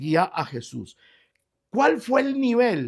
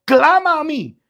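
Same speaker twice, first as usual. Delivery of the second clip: loud, higher ringing call; shouted cry; 8 semitones higher, 5 dB louder than usual. Shown as a demonstration. Preacher voicing God's command to the church, loud. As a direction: cry it out with full force. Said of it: "y entiéndalo ahora".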